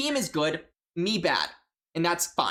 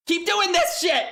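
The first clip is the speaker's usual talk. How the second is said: high, slow exasperated yell; frustrated yell; 12 semitones higher, 4 dB louder, slower than usual. Real, not acted. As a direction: vent at the opponents loudly. Venting about opponents, frustrated outburst.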